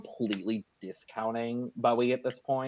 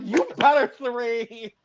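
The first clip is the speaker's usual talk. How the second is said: cheering; 11 semitones higher, 6 dB louder, slower than usual. Retold or imitated. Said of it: "And I'm like".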